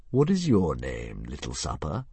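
slow, grand dragon; a lazy monster toying with prey. Acted. Narrator voices the dragon.

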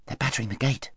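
hushed report to a companion; soft, whispered observation. A narrator's reading. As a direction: pass it on under the breath. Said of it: "whispered Corin".

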